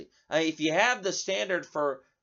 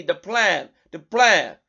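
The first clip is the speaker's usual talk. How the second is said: repeated phrase in a mock French accent, getting louder; imitated French accent; 7 dB louder, slower than usual. Radio host mocking Kamala's repeated phrase, put-on French accent. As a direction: parrot it in a fake French accent. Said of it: "over and over again in this French accent".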